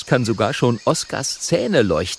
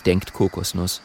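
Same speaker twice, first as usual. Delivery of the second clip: usual speaking voice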